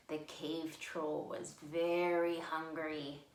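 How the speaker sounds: usual speaking voice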